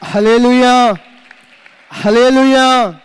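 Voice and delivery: loud, high, drawn-out hallelujah; hallelujah shout. Real, not acted. Preacher rousing the congregation with a loud drawn hallelujah. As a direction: lift the church with a slow ringing hallelujah.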